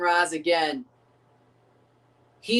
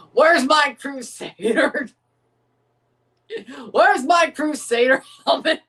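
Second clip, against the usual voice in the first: laughing while asking, rising; giggling through the question; 5 semitones higher, 5 dB louder than usual. Real, not acted. Laughing at Crusader jokes.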